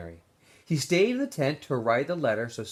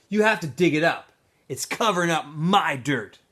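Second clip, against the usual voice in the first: louder, pushy order; bossy demand; 4 semitones higher, 6 dB louder than usual. Acted. Zigzag ordering Stanley to dig, loud and bossy. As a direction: lean on him like a bully.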